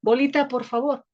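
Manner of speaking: lightly voiced street kid's request; chicos jugando fútbol. Kids playing football ask for the ball, lightly called out. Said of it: "escuchar de pronto que digan".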